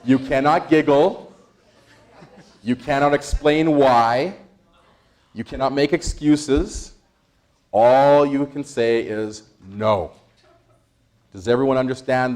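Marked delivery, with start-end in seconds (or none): 0.0-10.1 slow, deliberate laying down of rules; emphatic slow rules. Shown as a demonstration. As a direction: spell out each rule slowly. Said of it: "listen to the instructions".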